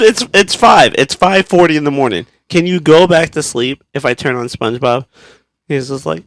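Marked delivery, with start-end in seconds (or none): 0.0-5.1 raised, exasperated reenacted pleading; loud retold plea easing down; exasperated parent. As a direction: settle from yelling into weary bargaining.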